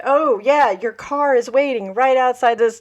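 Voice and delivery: bright, loud fake friendliness; mock cheerful lure. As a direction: sell the lie with a sunny, loud voice. Prank call to the Ramones.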